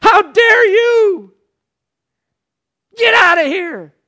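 very high, very loud outraged yell; preacher imagining himself as Jesus, screaming at the betrayer; furious shout. Pretend. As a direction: throw him out in a rage. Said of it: "I would have said".

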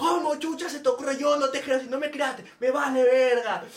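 yelling in mock fury, dissolving into a laugh; mock angry father. Padre actuado furioso. (padre furioso fingido, medio riendo)

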